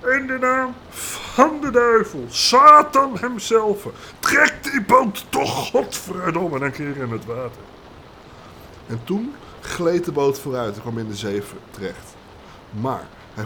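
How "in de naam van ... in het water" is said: much higher and louder, very slow, cursing; screaming with all his might. Acted. Desperate boatsman calling on the devil, full scream. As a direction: curse the boat into the sea. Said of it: "schreeuwde de bootsman uit alle macht".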